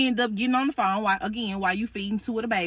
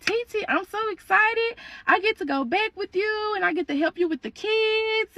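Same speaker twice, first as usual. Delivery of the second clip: put-on high, eager little-girl voice; acted excited child; 8 semitones higher than usual. Niece thrilled to go back with aunt, high excited gushing. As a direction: gush happily with the pitch way up.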